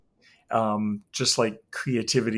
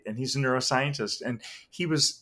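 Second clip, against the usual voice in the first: normal talking voice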